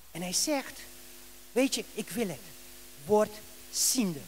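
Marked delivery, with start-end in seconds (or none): 1.5-4.2 low, hushed words with pauses; quiet pronouncement. Acted. Jesus grants blind Bartimeus his sight, quiet and low. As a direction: grant it softly, voice dropped low.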